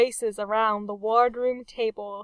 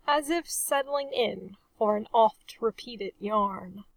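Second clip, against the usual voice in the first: usual speaking voice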